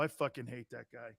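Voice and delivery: low, very soft retold aside; hushed, low-pitched grumble; quiet grumbled aside. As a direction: drop right down, as if confiding in a teammate. Retold aside to Ken Herrick.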